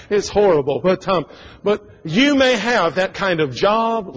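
normal talking voice

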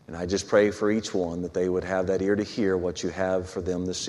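low, soft pastoral prayer; public prayer. Real, not acted. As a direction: pray quietly on behalf of the congregation. Leads the congregation in prayer.

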